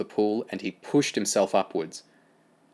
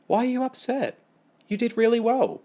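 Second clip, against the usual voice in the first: Amy, surprised and kind, voiced higher; higher-voiced Amy, gently puzzled; higher girl's voice, puzzled. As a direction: ask why he's upset, kindly.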